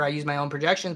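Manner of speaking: ordinary talk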